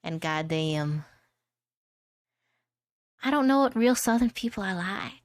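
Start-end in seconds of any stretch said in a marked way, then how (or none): 3.2-5.1 cartoon southern accent. Streamer admitting only knowing cartoons, cartoon southern accent.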